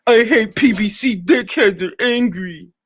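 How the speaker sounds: high, whiny parody of his video title; mocking the critic. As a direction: sneer it like a petty complainer.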